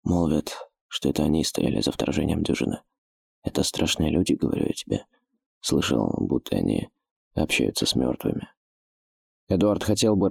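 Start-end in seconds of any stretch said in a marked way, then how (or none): 0.0-8.5 low, hushed thief voice; ominous thief, half-whispered. Acted. Thief tells ominous rumour.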